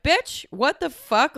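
high, slow, disbelieving outburst; outraged retort. Retold or imitated. Snapping back at the girl who judged her outfit. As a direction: stretch it out in offended shock.

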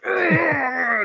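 long high strained grunt; mock straining groan. Podcaster imitating actors pumping iron just off camera, mock straining groan. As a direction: groan like heaving a heavy curl.

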